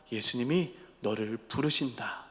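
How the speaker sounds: very soft, low delivery; gentle reassurance. Acted. Preacher as a disciple comforting the anxious Bartimaeus, hushed reassurance. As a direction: deliver the good news tenderly and quietly. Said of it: "그를 향하여 안심해라".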